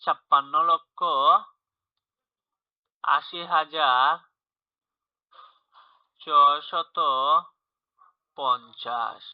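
ছাপ্পান্ন লক্ষ আশি হাজার ছয়শত পঞ্চাশ read slowly, with long pauses between the parts; slow spelled-out reading. Shown as a demonstration. Teacher repeats the number slowly so students follow. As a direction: give each place value its own beat. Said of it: "আবার বুঝো".

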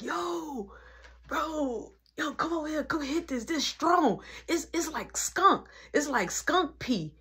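high, drawn-out calls of a hyped-up friend; imitating excited friend. Friend urging him to come hit this, imitated high and excited.

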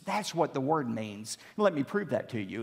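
ordinary delivery

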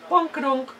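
high, loud cartoon squeal of a silly word; silly squeak. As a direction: squeak it like a bumped cartoon character. Silly noise while climbing.

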